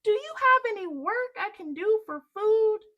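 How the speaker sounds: high, loud asking; calling up. Acted. Jack calling up for work, high and loud. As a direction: shout the request up.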